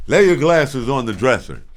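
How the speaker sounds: father ordering his son before a whipping; stern father. Retold or imitated. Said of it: "before he whipped my butt, say".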